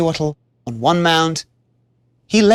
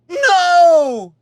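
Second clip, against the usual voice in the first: much higher, loud cry of grief; anguished cry; 14 semitones higher, 6 dB louder than usual. Acted. Warrior finding his dead love, anguished cry. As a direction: let it burst out. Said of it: "he cried".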